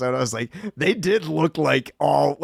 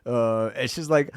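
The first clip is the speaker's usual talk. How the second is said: usual speaking voice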